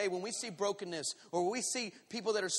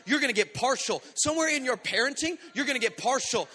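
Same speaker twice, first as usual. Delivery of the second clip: loud, raised, rhythmic sermon delivery; forceful preaching; 5 semitones higher, 6 dB louder than usual. Real, not acted. Preacher urging congregation to full obedience, forceful. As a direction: drive each phrase home to the congregation.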